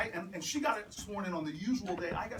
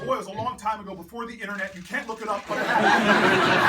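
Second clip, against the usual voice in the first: mock indignant dad; 2 semitones higher, 15 dB louder than usual. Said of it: "your parents get indignant when you challenge them".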